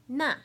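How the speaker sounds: "na" short, falling sharply from high; fourth tone. Shown as a demonstration. Teacher showing the four tones, "na" falling. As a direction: drop "na" fast from the top. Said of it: "I'll say the four tones".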